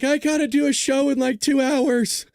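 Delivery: high, slow whiny complaint; exasperated self. Retold or imitated. To his audience about the late-night news, mock whining. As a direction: whine that you can't keep up. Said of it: "And I'm like".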